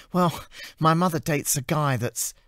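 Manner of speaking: bubbly and earnest with a warning; cheerful assistant. Acted. Amy warning Anna about the curfew, in a high cheerful voice.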